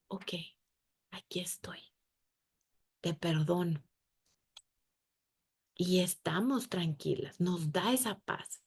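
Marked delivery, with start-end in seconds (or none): 0.1-3.8 whispered, soft reassurance; tender whisper. Pretend. God comforting the repentant, in a tender whisper. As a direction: breathe it gently, comforting. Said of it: "Sentimos su calor de decir".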